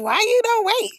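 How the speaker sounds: mock complaint. Imagined viewer pestering the out-of-breath host, mock complaint. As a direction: voice a pestering heckler. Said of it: "I don't wanna hear nobody say nothing like".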